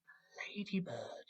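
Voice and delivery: soft, breathy whisper; hushed reveal. Pretend. Storyteller pulling a ladybird from the bag, whispering. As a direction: whisper it like a delicate discovery.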